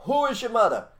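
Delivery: teacher's call. Retold or imitated. Soviet teacher quizzing schoolkids, high and loud. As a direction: ring out the question to the class.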